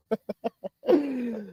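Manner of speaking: high squeaky giggle. Real, not acted. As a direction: break into a high, thin giggle. Laughing at own chat reading.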